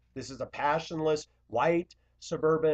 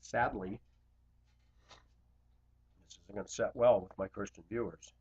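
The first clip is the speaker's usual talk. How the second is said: usual speaking voice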